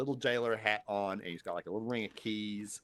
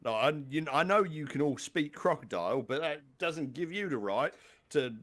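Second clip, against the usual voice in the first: indignant put-on English accent, pitched up; English crocodile jailer; 4 semitones higher, 4 dB louder than usual. Crocodile jailer scolding prisoners for yelling, indignant mock English accent. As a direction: grumble a telling-off as a comic English guard. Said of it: "I don't know why he's English now".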